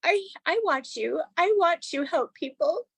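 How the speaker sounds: high, light child's voice imitating her ten-year-old; son's voice. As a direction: voice the little boy tapping sincerely. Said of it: "And he was like".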